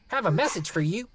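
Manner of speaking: clipped voice of the post office man; voiced postal clerk; gruff clerk voice. A narrator's reading.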